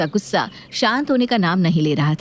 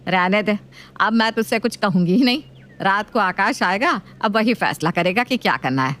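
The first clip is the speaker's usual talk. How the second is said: nagging mother-in-law voice. Acted.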